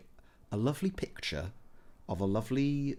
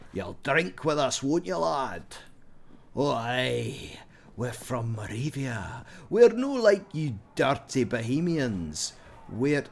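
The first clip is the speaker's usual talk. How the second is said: put-on hearty, louder Scots-like drinking-buddy accent; Moravian drinker voice; 2 semitones higher, 3 dB louder, slower than usual. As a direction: play a boisterous Moravian villager inviting you to drink. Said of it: "I'm like one of the Moravians".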